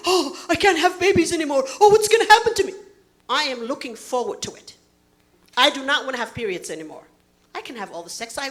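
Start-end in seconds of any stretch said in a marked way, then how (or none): 0.1-2.7 high-pitched, hammed-up fretting; mock worried. Comedian mimicking women worried about menopause, mock-worried wail. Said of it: "we're worried".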